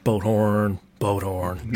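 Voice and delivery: low, laughing boat horn blast; boat horn impression. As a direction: give a deep boat horn call. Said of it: "You can do a better boat horn than honk, honk".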